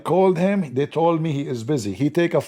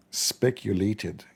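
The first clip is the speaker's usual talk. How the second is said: "Speculated" said very slowly and softer, each syllable drawn out; savoring the word; 4 semitones lower, 5 dB quieter, much slower than usual. Shown as a demonstration. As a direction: let "Speculated" roll out slowly like a movie title. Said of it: "This word alone is a movie".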